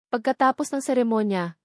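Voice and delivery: usual speaking voice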